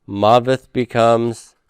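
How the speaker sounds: ordinary talk, nothing marked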